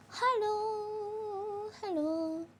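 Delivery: cutesy high voice. Pretend. Greets viewer in put-on voice.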